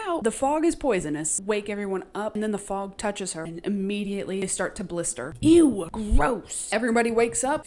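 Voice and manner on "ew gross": loud grossed-out "ew"; a loud, genuinely grossed-out reaction; disgusted. Real, not acted. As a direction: recoil in disgust. Reacting to blistering fog.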